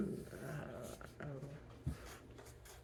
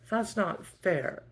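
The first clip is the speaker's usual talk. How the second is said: put-on whiny child protest; 8 semitones higher, 4 dB louder, slower than usual. Daughter protesting dishes.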